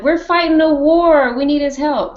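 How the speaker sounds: high, pushy exasperated voice; mock urgent council. High exasperated voice, council arguing with Sansa. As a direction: push back, exasperated. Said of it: "they'd have been like".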